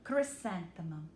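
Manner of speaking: ordinary delivery, nothing marked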